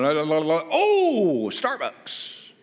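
a daydreaming driver who spots coffee; distracted driver. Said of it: "I'm going".

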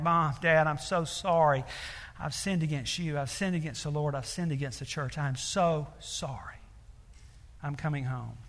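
a daughter come home, softly apologizing to her parents; broken apology. Retold or imitated. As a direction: let it sink to near quiet, repentant.

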